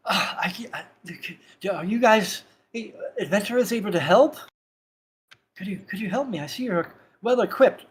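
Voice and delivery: put-on high, frail, panicky old man; breathless old man begging for help. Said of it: "kind of frail old man comes running up to you and kind of in a panic".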